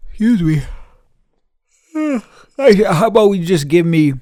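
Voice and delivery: barely holding it together, fake polite; exasperated. Asking the clerk for the right parts, strained and fake polite.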